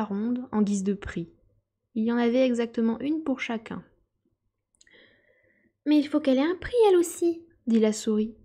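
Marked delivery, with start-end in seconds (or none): 5.9-7.4 high, pompous lecturing voice; self-important mouse. A narrator's reading. Mouse lectures the animals, high and pompous. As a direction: recite dry history like a proud little professor.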